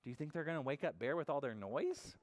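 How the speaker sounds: plain reading voice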